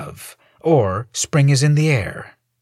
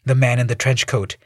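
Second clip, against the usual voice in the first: ordinary delivery, nothing marked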